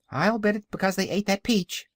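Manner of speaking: high-pitched little kitten; high kitten cry. A narrator's reading. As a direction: pipe up as a kitten. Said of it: "cried the kitten".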